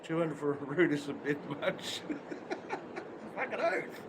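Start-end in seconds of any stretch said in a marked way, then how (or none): 2.1-3.3 a high, airless giggle; high breathless laugh. Real, not acted. Laughing at his own joke about prices. 3.4-4.0 laughing agreement. Real, not acted. Agreeing with himself while still laughing.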